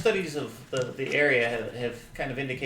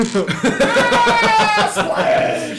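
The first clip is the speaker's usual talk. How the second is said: high laughing shout of splash; laughing mock splash; 11 dB louder than usual. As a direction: squeal it out through laughter. Raft capsizes, laughing.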